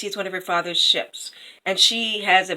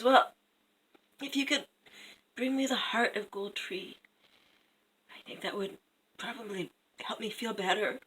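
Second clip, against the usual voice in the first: soft, halting sickbed voice; mock-frail queen, very quiet; feigned weak invalid; 4 semitones higher, 8 dB quieter than usual. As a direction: fade to a feeble, hesitant plea. Sick queen asks for heart.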